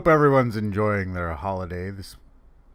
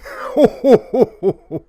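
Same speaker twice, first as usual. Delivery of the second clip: loud, high ho-ho-ho belly laugh; big booming laugh at your own joke; hearty Santa laugh; 12 semitones higher, 10 dB louder than usual. Acted. Santa laughing at his own funny poem, loud and hearty.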